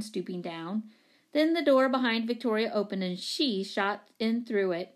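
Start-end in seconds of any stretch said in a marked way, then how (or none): none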